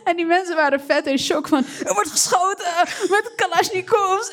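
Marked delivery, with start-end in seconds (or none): 1.9-4.3 shocked. Retold or imitated. Voicing shocked new neighbours.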